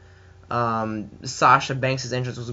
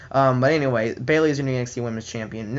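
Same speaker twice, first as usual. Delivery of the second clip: normal talking voice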